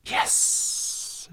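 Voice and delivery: whispered serpent hiss. A narrator's reading. The serpent studies the scale, whispered hiss. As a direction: stretch the S into a long hiss. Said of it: "she hissed".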